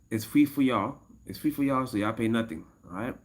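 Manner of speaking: usual speaking voice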